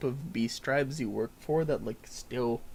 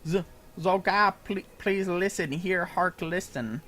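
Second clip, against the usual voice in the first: higher, louder pleading voice; pleading creature; 5 semitones higher, 6 dB louder than usual. Acted. Small kobold begs Zolga to listen, higher pleading.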